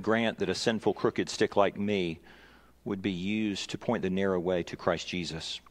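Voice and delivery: low, subdued pastoral prayer. Real, not acted. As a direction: pray softly on behalf of the congregation. Leads congregation in prayer.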